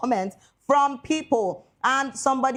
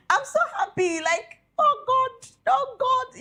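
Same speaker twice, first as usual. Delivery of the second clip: high, drawn-out delighted squeal of oh God; giddy squeal of delight; overjoyed squeal; slower than usual. Real, not acted. Overjoyed over couple's baby.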